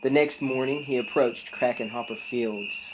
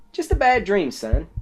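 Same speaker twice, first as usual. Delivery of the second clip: dad reassuring; 8 semitones higher, 7 dB louder than usual. Acted. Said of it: "his dad said".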